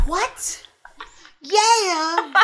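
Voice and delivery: high, drawn-out hype-man hollers. Acted. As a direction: holler it high, half-laughing. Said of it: "he does a great Little John".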